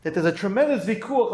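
normal talking voice

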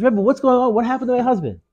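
high-pitched, acted alarm of a worried wife. Worried wife pleads with Rebbe.

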